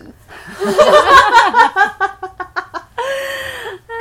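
loud, high, long laughing fit; long high cackle. Real, not acted. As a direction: crack up loudly for a long time. 笑公民小老师. (高声狂笑很久)

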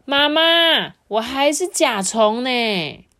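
put-on high, loud, drawn-out child's call; storybook boy shouting to his mother. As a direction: call out loudly to get mom's attention. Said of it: "卡夫卡大声地说".

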